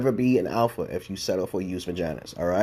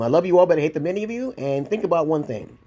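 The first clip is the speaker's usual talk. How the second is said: pitched-up grand pronouncement; high declaration; 8 semitones higher, 3 dB louder than usual. Acted. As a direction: announce it high like a proclamation.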